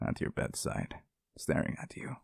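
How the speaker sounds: unsettling hushed whisper; eerie whisper. Acted. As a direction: drop to a breathless whisper. Creepy promise to watch them sleep.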